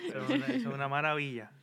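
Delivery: fast words through laughter; laughing delight. Real, not acted. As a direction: gush about it while laughing. Laughing at the mild weather.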